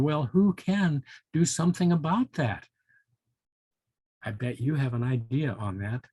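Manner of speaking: plain reading voice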